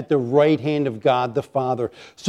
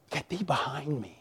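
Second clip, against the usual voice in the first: very soft, muttered rebuke; hushed rebuke; 16 dB quieter than usual. Acted. Preacher warding off talk of new cars, hushed. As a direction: drop it right down under your breath. Rebuking temptation under his breath.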